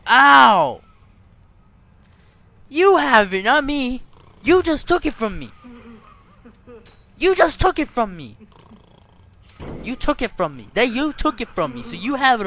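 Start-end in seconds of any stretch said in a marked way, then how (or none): 0.1-0.8 loud high yelp of pain. Real, not acted. Gamer hit by inmate, loud high yelp. Character gets punched in game. 2.7-11.4 higher, louder indignant protest. Real, not acted. Gamer arguing with inmate over cigarette, higher indignant protest.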